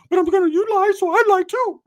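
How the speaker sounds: very high, loud cartoonish squeak; squeaky Ricky voice. Ricky squealing back at his teasing cousin. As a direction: squeal it out like Mickey Mouse.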